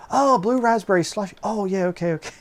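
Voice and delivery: high, loud oh of dawning understanding; light up as it finally clicks; sudden realization. Retold or imitated. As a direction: cashier finally getting the order, high relieved oh.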